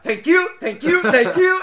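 high, loud, breathless gratitude; grateful girl gushing thanks at the little men. Retold or imitated. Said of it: "She was like".

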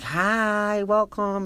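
high, drawn-out sweet hello; loving welcome. Retold or imitated. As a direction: imitate a kind host's delighted greeting. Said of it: "she was so nice and so loving".

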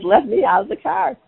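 half-mock panic to be let out; scared plea. Mom in the back seat begs her sons, mildly scared. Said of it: "And I got scared".

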